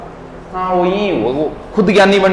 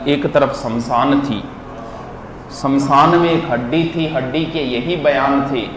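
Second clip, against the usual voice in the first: low, measured shayari delivery; verse recitation; 5 semitones lower, 5 dB quieter than usual. Acted. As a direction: recite the couplets softly, lower than usual.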